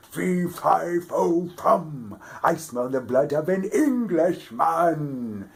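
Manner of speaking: loud, high booming giant; giant's roar. A narrator's reading. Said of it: "The giant roared".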